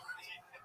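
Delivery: short laugh. Real, not acted. Laughing at own joke.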